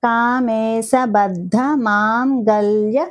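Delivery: కామేశబద్ధ మాంగళ్య drawn out slowly; slow careful recitation. Shown as a demonstration. Teacher reciting the name slowly for learners. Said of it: "slow recitation demo".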